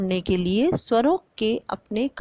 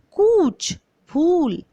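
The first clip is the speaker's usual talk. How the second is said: कूच and भूल said higher and louder, the long ऊ punched; long oo stressed; 6 semitones higher, 4 dB louder, much slower than usual. Shown as a demonstration. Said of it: "इसे जोर दे के बोला जाता है". (बड़े ऊ पर ज़ोर देकर "कूच, भूल")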